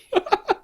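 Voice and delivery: laughing. Real, not acted. Laughing at the tweet.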